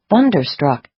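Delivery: usual speaking voice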